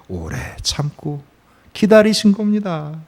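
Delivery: normal talking voice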